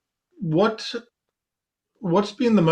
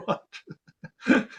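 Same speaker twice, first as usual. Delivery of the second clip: laughing; 7 semitones higher than usual. Real, not acted. Laughs at own pineapple story.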